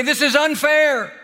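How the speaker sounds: high, drawn-out mock protest; slow, high-pitched complaint; aggrieved cry. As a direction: imitate someone crying out at an injustice. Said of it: "They didn't cry out and say".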